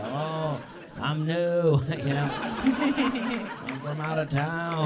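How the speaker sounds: high, innocent lost-tourist voice; mock clueless newcomer. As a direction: play the sweet out-of-towner, drawn out. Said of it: "I go".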